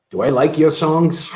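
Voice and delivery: slow, put-on dad voice; dad impression. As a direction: draw out the punchline as dad. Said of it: "And so Dad goes".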